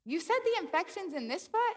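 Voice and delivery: high, questioning doctor voice; puzzled doctor. Retold or imitated. Doctor doubting her infection story, high and puzzled. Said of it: "And he's like".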